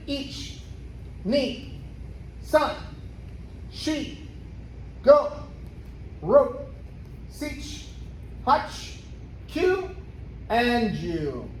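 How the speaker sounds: barked Japanese count; sharp martial arts commands. Shown as a demonstration. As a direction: call each count like a sensei. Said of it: "I'm gonna count and she's gonna execute".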